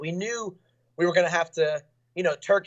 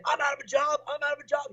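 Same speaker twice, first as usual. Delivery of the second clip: higher, whiny mimicry of the rattled opponent; mock rattled opponent; 9 semitones higher than usual. Fighter mimics the beaten opponent ranting mid-fight, higher mock whine. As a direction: imitate him losing it, voice pushed up high. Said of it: "He was saying".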